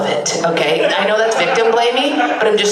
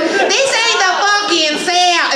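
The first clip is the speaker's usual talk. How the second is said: high, slow mock Southern protest; drawn-out high Southern accent; mad Southern local; 10 semitones higher, much slower than usual. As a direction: imitate an indignant local with a Southern twang. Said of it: "they're like".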